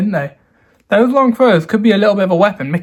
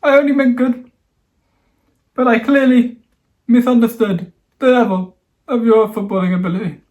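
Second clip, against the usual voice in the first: much higher, pleading mock sorry; mock pleading apology; 5 semitones higher than usual. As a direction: beg forgiveness in a high, hammed-up voice.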